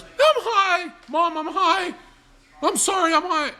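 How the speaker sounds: put-on very high, loud girl's wail; hammed-up squealed confession at full pitch; mock panicked shriek. Teenage girl confesses to mom, mock shrieking.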